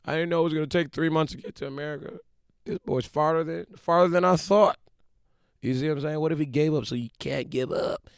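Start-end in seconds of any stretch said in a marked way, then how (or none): 0.0-4.8 high, whiny mock complaint; hammed-up whining, building to a loud cry; Christopher Columbus giving up. Explorer complaining about the long trip to America, mock whining. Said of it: "Imagine if Christopher Columbus says".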